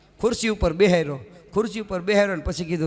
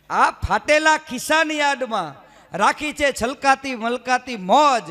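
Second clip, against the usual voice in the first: slow, lifted recital with a laugh; poem recital; 4 semitones higher, slower than usual. Shown as a demonstration. Comedian repeating a favourite poem line, slow and smiling. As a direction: relish the line as you repeat it. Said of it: "ચાર લીટી ગમે છે".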